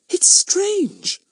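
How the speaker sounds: put-on high, wondering little girl; girlish voice, puzzled; young girl voice. A narrator's reading. Betts voiced by narrator.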